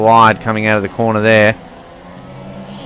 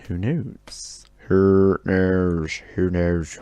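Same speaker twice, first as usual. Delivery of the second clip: silly put-on voice; 2 semitones lower, 5 dB quieter, much slower than usual. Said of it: "I don't know why I'm speaking like this".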